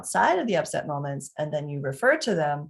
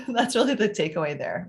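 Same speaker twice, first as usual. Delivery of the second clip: ordinary talk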